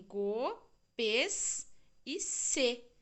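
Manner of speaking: "pes e c" dito muito devagar; syllables spelled out. Shown as a demonstration. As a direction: soletre com calma. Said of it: "Essa é difícil". (sílabas ditas lentamente)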